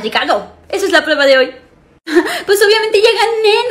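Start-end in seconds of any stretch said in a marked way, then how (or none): 2.5-3.7 laughing. Acted. Laughing boast.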